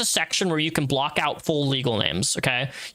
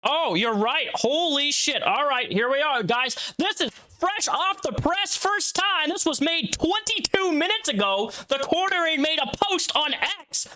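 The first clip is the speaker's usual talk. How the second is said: high-pitched, hyped breaking-news call; excited announcement; 9 semitones higher, slower than usual. Real, not acted. Streamer spots a brand-new post while live, excited announcement. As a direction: stretch it out high like a hot scoop.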